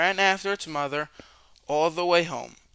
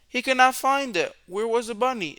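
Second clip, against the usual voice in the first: higher, louder worried cry; alarmed; 8 semitones higher, 4 dB louder than usual. A narrator's reading. Narrator reads George failing to find the bunny, higher and louder. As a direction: let the alarm rise.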